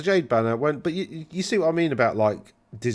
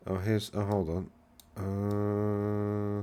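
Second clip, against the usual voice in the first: ordinary talk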